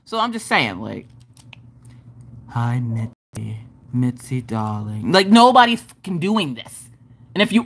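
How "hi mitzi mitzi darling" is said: slow, low, soft drone; drawn-out lifeless greeting; monotone. Shown as a demonstration. Showing viewers the weird dull hello, slow monotone. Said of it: "how monotone your ass is".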